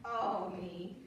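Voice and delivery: ordinary delivery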